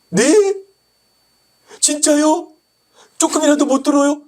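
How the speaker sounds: very high, loud disbelieving questions; acted shock, voice shooting up; shocked patient.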